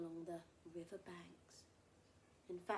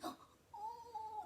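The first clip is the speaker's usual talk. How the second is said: frightened gasp; 13 semitones higher, 5 dB quieter than usual. Acted. Elephant frightened by crocodile.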